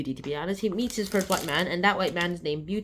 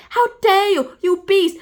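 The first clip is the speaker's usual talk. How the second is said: much louder, higher outraged yell; 13 semitones higher, 11 dB louder, slower than usual. Acted. The expert yelling at Beauty in outrage. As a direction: blast it in shocked outrage. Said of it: "the mind expert comes out".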